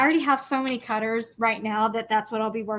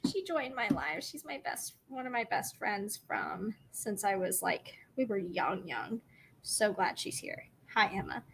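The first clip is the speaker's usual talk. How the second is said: softly moved; 3 semitones lower, 12 dB quieter than usual. Real, not acted.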